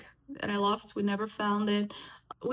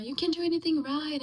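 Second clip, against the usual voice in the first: higher, faster retold yell at a child; an imitated mother's screamed insult, higher and fast; mock screaming mother; 6 semitones higher, faster than usual. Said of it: "she was screaming and she was hitting me".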